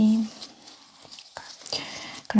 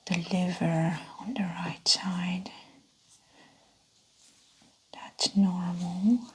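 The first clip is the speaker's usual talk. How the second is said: hushed, whispery clinician voice; ASMR exam role play. Pretend. Examiner checking a patient's belly, hushed ASMR whisper. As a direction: talk a patient gently through an exam, half whispered.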